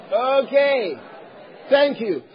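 normal talking voice

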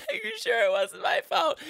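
a mom fishing for reassurance; anxious mother. Acted. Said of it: "worrying my parents".

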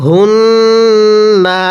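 হুন্না with a held nasal ন্ন, said slowly; nasal ghunnah. Shown as a demonstration. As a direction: hum the ন্ন in হুন্না without stretching the final vowel. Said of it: "কিভাবে পড়ব?".

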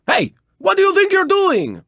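put-on high, indignant Spanish-accented outburst; indignant protest. Racer challenging a rival who got in the way, indignant.

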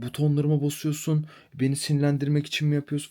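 mildly high, put-on whiny landlady; mock landlady tantrum. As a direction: lightly play her huffy accusation. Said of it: "falan böyle bir tribe girdi".

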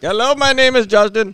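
loud, high imitation Indian accent; mock Indian call-center greeting. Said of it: "I'll give him that voice".

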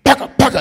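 loud sharp call; nail gun shout. Retold or imitated. Dad working the nail gun, shouting loudly.

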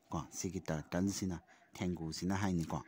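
ordinary talk

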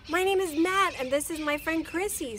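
high, loud eager introduction; bursting with excitement; excited boy. Acted. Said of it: "said a surprised Matt".

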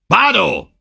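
high, loud sharp order; loud command. A narrator's reading. Engelhorn shouting an order at the advancing natives, high and loud. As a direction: stop the crowd with a shout. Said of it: "rief er laut".

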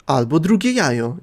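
usual speaking voice

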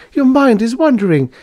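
very high female character voice; high, loud lovesick girl; plaintive young lady. A narrator's reading. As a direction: wail reproachfully at your lover.

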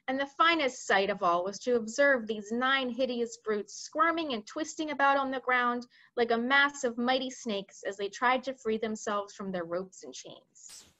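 plain reading voice

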